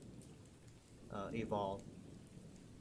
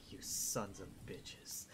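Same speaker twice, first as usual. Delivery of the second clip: slow, seething whisper; whispered curse; 5 semitones lower, 12 dB quieter, slower than usual. Acted. Gilmore glares at the party, seething whisper. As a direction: hiss it through a glare.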